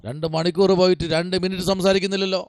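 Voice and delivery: ordinary talk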